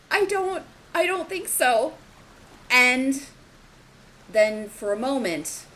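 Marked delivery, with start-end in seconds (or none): 0.1-1.9 much higher woman's voice, doubtful; a woman answers through the storm, high and fearful; feminine voice. Acted. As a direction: lift into a scared woman's reply. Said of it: "a more feminine voice".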